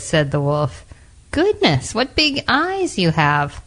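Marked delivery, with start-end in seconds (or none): 1.3-3.6 very high, drawn-out astonishment; amazed little girl. A narrator's reading. Girl stares at Grandma's big eyes, high and amazed. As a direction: marvel in a high voice, slowly. Girl marvels at disguised wolf.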